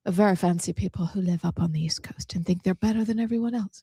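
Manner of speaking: fast breathy posh Connecticut voice; a hint of Connecticut snob accent. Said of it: "or a Connecticut accent".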